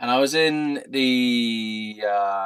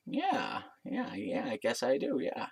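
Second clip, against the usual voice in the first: softer, hesitant mimicry; put-on bartender voice; 9 dB quieter, slower than usual. Bartender answering the offer of a snog, soft put-on voice. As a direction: mimic him agreeing quietly. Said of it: "These voices are amazing".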